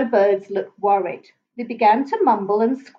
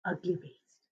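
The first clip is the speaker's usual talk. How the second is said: hushed ugly beast; 5 semitones lower, 15 dB quieter than usual. A narrator's reading. Repeating caption much softer.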